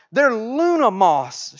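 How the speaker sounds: high, slow, drawn-out exclamation; storybook shout. A narrator's reading. Middle daughter spots two gigantic moths, storybook shout. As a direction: announce it in a stretched, mild shout. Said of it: "shouted the middle daughter".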